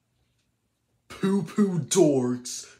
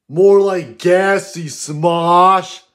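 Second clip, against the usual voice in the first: loud, slow, higher mock-furious yell; angry yelling rant; 5 semitones higher, 11 dB louder, slower than usual. Kid character roasting a rival channel, furious yell. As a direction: bellow the insult, dragging every word.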